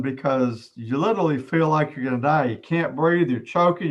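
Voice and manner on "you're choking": usual speaking voice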